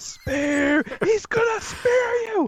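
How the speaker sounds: shrieking, very high manager yell; shrill gloating wrestling manager. Retold or imitated. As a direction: scream a warning like a frantic manager. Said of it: "had roared at him".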